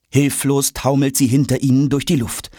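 ordinary delivery

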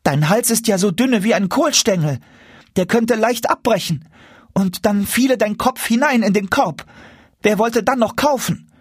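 put-on very high, worried child's voice; anxious little boy. A narrator's reading. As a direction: pipe up as a fretful small boy. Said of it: "rief der Kleine ängstlich". (hohe, besorgte Kinderstimme)

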